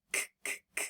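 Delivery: k, k, k as bare breathy clicks with no voice; demonstrating voiceless k; unvoiced k. Shown as a demonstration. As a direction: whisper the k, k, k sound. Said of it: "is that a voice sound?".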